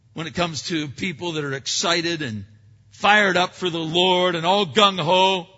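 ordinary talk, nothing marked

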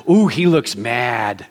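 low, slow mock dread; mock wary onlooker. Shopper spotting the pastor at Target, low mock warning. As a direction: drop low and draw it out warily.

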